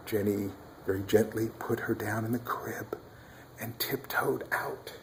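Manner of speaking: soft unvoiced whisper. Real, not acted. Baby just fell asleep.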